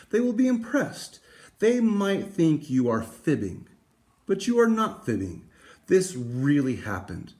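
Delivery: lively picture-book reading voice; story read aloud. Acted. Teacher reading a picture book to students, lively. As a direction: read it brightly to the class.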